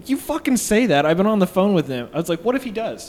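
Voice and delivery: indignant retort. Retold or imitated. Arguing with dismissive owner.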